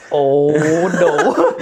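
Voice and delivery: drawn-out mock dismay; comic dread. Passenger sees the angry driver get out, comic dread. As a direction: stretch it out in sinking mock dread.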